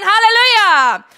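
loud, high hallelujah cry; full-voiced praise shout. Real, not acted. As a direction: throw the hallelujah out to the hall. Preacher's exclamation at peak.

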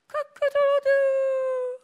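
very high, slow crowing; rooster crow. Retold or imitated. Preacher imitating the rooster, high and drawn out.

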